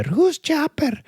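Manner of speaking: put-on high, cooing girlish voice; Pulp Fiction girlfriend. Girlfriend cooing at her boyfriend, high and sweet. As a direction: coo it like a sweet, naive girlfriend. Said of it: "That's, that's Pulp Fiction".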